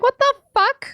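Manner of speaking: high, loud outburst of disbelief; shocked disbelief. Retold or imitated. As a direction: blurt it out, stunned. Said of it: "And I'm like".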